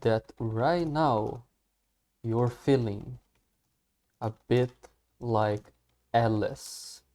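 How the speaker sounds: slow, careful English model line; slow teacher demo of the line; slow model reading. Shown as a demonstration. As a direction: read it out slowly for students to copy. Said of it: "Beleza, então I imagine".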